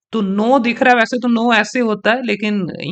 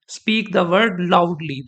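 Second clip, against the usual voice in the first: very slow, loudly said louder; stress on loudly; much slower than usual. Shown as a demonstration. Teacher demonstrating that stressed words are said louder. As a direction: drag it out and punch loudly. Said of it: "loudly को भी मैं loudly".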